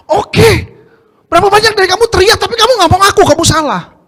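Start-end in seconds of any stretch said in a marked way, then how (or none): none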